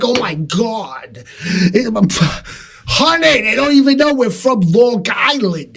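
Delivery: high-pitched, hammed-up Long Island caricature; Long Island accent. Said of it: "a Long Island accent too".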